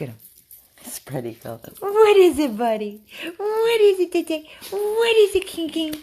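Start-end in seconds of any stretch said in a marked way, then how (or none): 1.8-6.0 loud, high excited squeals; high-pitched dog talk. Real, not acted. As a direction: bursts of squealing pet talk. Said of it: "He loves when I talk all high pitch".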